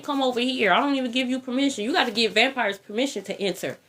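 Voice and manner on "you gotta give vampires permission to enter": ordinary delivery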